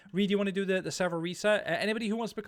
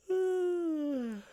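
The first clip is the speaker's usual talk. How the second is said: long yawn. Real, not acted. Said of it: "I'm yawning already".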